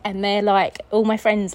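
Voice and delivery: ordinary delivery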